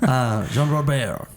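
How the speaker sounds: slow French accent. Shown as a demonstration. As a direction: say the name like a Frenchman. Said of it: "that was French and then Spanish".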